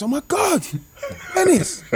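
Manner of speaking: high-pitched squeal of delight; star-struck shriek, acted out; retold excited scream. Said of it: "I screamed".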